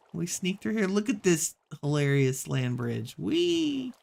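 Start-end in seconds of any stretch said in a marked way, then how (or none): 3.2-3.9 high squealed whee; gleeful whee. Real, not acted. Gamer sliding across a land bridge, gleeful squeal. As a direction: let out a playful squeal.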